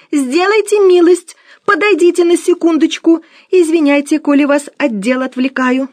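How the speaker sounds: high, loud fussy housekeeper; fussy plea to come over; Musya's high voice. Acted. Musya begging her husband to come, high and loud.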